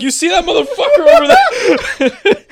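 high, slow, laughing panic; panicked friend voice. Retold or imitated. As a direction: squeal it out in mock panic. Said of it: "Efrain's like".

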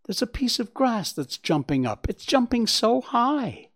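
high, wondering fawn voice; excited young Bambi. Acted. Bambi shows his mother the jumping grass, excited. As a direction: marvel in a high voice, stretching the end.

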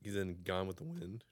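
normal talking voice

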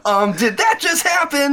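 high, hammed-up disbelief; comic book dialogue. Mocking comic book lines about his own stage moment, high and hammed-up. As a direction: pitch it way up as a corny catchphrase. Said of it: "that stupid, uh, comic book dialogue".

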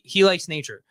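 ordinary talk

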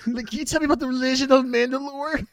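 high-pitched eager fan question; mock nerd. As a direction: ask about lore in a put-on nerd voice. Mock nerd asking lore question.

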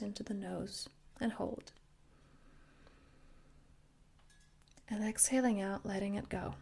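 soft, paced breathing guidance with long pauses; guided breathing meditation. Acted. Coach guiding listeners through inhale and exhale, softly meditative. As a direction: gently lead each breath in and out. Leads the breathing exercise again.

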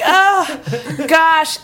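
high, loud mock-horrified wail; retold dismay. Hearing uncle swinging at the bat, mock horror.

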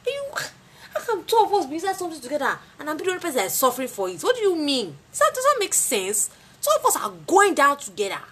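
rapid high complaint; high, fast indignant rant. Real, not acted.

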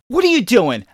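high, loud mock scolding; acted sharp confrontation; imitated angry mom. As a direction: demand it like an exasperated mother. Mom catches up, acted quarrel.

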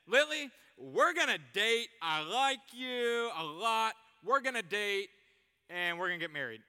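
pushy suitor. Acted. Acting pushy suitor to Lily.

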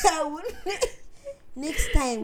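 high laughter breaking through; laughing. Real, not acted. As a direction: laugh through the mock threat. Laughing mock threat.

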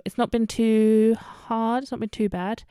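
ordinary talk

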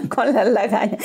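laughing through the words; real amused laugh. Real, not acted. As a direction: giggle the phrase out. Laughing at her own story.

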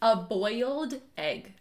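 shape each word slowly and clearly; over enunciating. Shown as a demonstration. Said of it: "hear you whispering a little bit".

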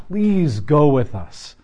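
slow, drawn-out plea; slow earnest begging. Retold or imitated. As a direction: beg him not to leave. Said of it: "Moses is basically begging God".

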